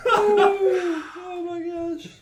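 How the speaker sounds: very high, loud, drawn-out laughing wail; helpless laughing squeal; laughing exclamation. Real, not acted. Overcome by the joke.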